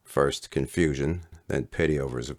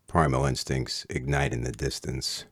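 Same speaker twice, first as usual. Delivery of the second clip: plain reading voice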